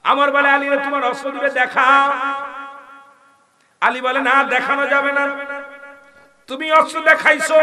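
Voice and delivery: high, loud fiery sermon voice; preaching at full force. Acted. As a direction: preach it out with fire. Preacher in full force telling story.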